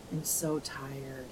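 very quiet, slow, worn-out voice; exhausted sigh. Retold or imitated. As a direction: droop through it like you're drained.

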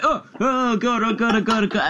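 panicked. Retold or imitated. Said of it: "they're just like".

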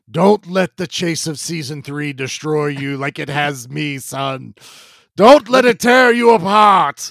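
dying words building to a shout; overacted death scene. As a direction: ham it up, last breath to a cry. Mock dying farewell to son.

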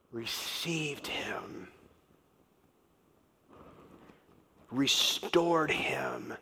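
hushed secret, slowly; whisper. Retold or imitated. Boy whispers the news.

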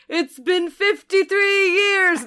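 hammed-up old grandpa; mock exasperated grandpa. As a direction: drag it out like a tired grandpa. Mock grandpa answering phone.

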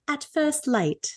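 slow, lightly British delivery; mock British, drawn out; British accent. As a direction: put on a playful British accent. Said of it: "I don't know why she has a British accent".

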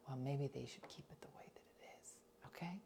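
whispered scolding in the pew; hiss-whisper an argument so nobody hears; hushed church argument. Retold or imitated.

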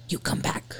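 soft eerie whisper. Retold or imitated. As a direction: whisper it like a ghost. Whispering the EVP.

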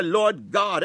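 normal talking voice

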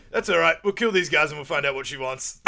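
quoting a cocky soldier brushing off the problem; acted Chris line. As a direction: swagger through it. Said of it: "And then Chris goes".

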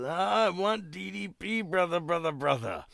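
high, raspy wrestling promo growl on brother, brother; Macho Man impression. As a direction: channel a wild wrestling promo. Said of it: "he says".

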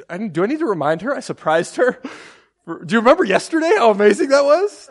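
high, loud, rushed protest; hammed-up aggrieved inner argument; indignant rant. Retold indignant inner rant about wife.

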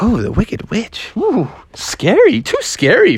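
high, drawn-out spooky teasing; playfully spooked friends; mock scared. Friends mock the scary pick.

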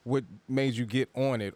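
ordinary talk, nothing marked

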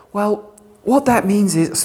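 slow, drawn-out mocking "Well, what that means is"; mock-knowing sarcastic drawl. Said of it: "forgive me being sarcastic".

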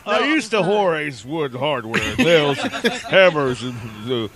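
low, rambling commercial pitchman; John Madden. Acted. Doing an old hardware commercial, low bumbling celebrity impression. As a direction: trail through the list, pitched low.

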